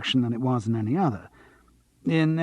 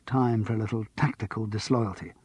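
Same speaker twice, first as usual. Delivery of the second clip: ordinary delivery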